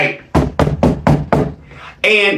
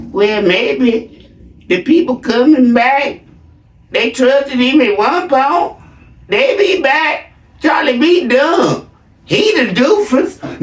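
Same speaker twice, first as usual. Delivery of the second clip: slow, high, drawn-out imitation; mocking gullible followers; 7 semitones higher, slower than usual. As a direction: mimic slow-witted believers, dragging each phrase out high. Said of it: "Y'all sitting up here like".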